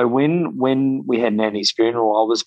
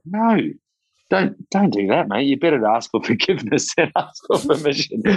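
higher, brisk and dismissive; Colin, waving it off; 5 semitones higher than usual. Retold or imitated. Mentor brushing off the young man's worry, higher and brisk. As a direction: tell him not to bother asking, half laughing. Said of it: "He's like".

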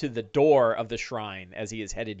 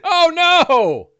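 high, loud oh no; gleeful fake despair at the crit; hammed-up alarm; mock dismay; 8 dB louder than usual. Real, not acted. GM reacts to critical hit.

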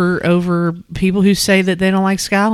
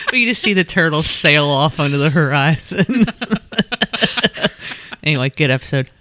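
ordinary delivery

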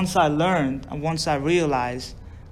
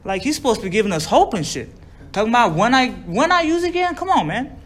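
higher, louder indignant complaint; upset; 5 semitones higher, 5 dB louder than usual. Retold or imitated. Retelling his complaint to a counselor about the group leader, upset. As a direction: protest in disbelief, pitch jumping up. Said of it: "And I'm upset now".

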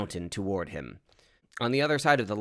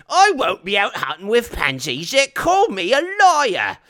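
high, loud outraged orc voice; indignant orc; 13 semitones higher, 12 dB louder than usual. Acted. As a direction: protest at full volume like an insulted orc.

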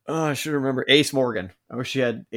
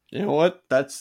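excited; 6 semitones higher, 9 dB louder than usual. Retold or imitated. Retold reaction, quoted.

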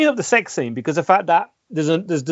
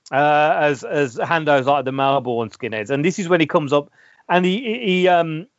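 ordinary talk

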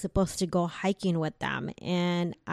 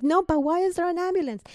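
high, fast pleading protest; panicked insistence; 10 semitones higher, faster than usual. Retold or imitated. Woman brushing off her sister's calm down, high panicked protest. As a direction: push back in rising panic.

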